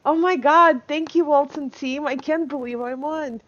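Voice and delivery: over-the-top grateful squeal of a pretend winner; mock excited winner. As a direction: play the thrilled raffle winner, hammed-up. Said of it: "you're supposed to say".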